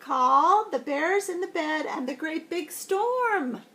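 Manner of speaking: high, bright storybook opener; reading to kids, lifting the voice high; excited intro. Acted. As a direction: announce the book with big excitement. Excited opening to children.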